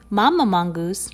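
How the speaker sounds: plain reading voice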